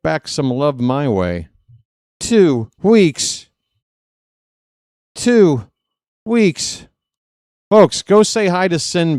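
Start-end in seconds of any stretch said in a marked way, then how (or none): 2.2-3.5 high, loud movie line; hammed-up Total Recall impression; Total Recall quote. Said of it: "any Total Recall fans out there?". 5.2-6.9 hammed-up broken catchphrase; drawn-out Total Recall quote. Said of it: "any Total Recall fans out there?".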